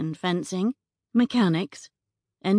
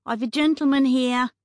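higher, louder call through the door; 7 semitones higher, 5 dB louder than usual. Acted. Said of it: "Beatrice called out".

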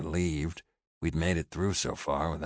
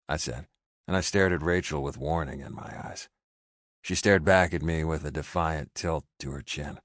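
usual speaking voice

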